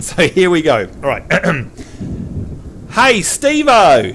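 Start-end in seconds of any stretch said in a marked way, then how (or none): 2.9-4.1 drawn-out, higher Aussie call; Australian accent demo. Shown as a demonstration. Host models an Aussie greeting for repeating, Australian accent. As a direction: call it slowly in broad Australian. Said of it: "show you how to talk Australian".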